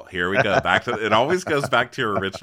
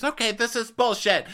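mock scream; 12 semitones higher than usual. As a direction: yell it at Mary. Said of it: "Jenny screams".

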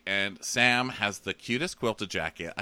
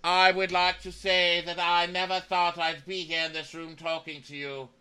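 mock speech; 9 semitones higher than usual. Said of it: "where I give a speech".

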